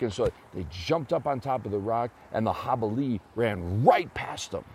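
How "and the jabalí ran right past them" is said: ordinary delivery, nothing marked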